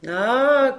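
long, high, loud "aah"; cried-out "ah". Acted. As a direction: exclaim with a drawn-out cry. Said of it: "cria madame Madeleine". (cri haut et prolongé)

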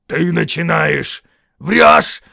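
loud, high troll retort; quarrelsome accusation. A narrator's reading. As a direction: hurl the blame straight back. Trolls accuse each other.

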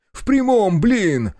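high, exasperated snap; angry bark. A narrator's reading. Brother barking at the narrator who plays dumb, angry bark. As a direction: bark it back, fed up. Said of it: "рявкнул он".

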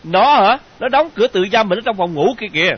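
loud, high, exasperated reply; irate shouting. Acted. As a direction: still fuming, shout the answer. Irate man answering after his outburst.

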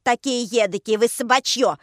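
loud taunting cry in a foreign voice; shouting. A narrator's reading. Kinga yelling at the Russian lads, loud and foreign. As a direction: hurl it like a mocking foreigner. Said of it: "кричит".

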